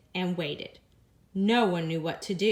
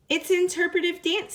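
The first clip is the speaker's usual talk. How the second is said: high, louder, grand announcement; proud teddy bear; 8 semitones higher, 4 dB louder than usual. Acted. Mister Slinger announcing the dance to the guests, proud. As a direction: declare it like high art.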